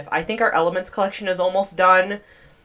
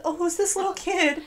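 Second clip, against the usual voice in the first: high, soft coo; cooing surprise; 10 semitones higher, 4 dB quieter than usual. Real, not acted. Player spotting a little kid on the trail, high cooing surprise. Spots a small child in game.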